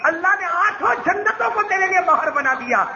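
high, impassioned proclamation; joyful. A narrator's reading. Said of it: "خوشی میں بدل گیا". (خوشی بھری بلند آواز میں خوشخبری)

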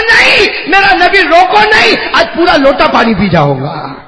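high, loud laughing cry; laughing shout of joy; excited laughing plea. Acted. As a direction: beg through laughter. Companion begging to keep drinking.

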